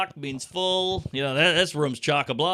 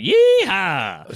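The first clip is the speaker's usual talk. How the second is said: mock yeehaw; 3 semitones higher, 6 dB louder than usual. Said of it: "They're just like".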